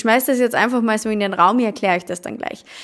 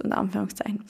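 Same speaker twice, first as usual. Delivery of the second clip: whispered aside; 9 dB quieter, much faster than usual. Shown as a demonstration. Podcast explaining ego, air quotes.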